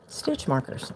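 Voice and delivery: ordinary talk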